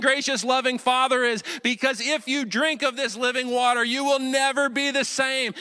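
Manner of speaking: high, sustained, urgent proclamation; impassioned preaching. Real, not acted. Pastor urging the church to drink living water, impassioned. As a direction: preach it with rising fervour, pitched high.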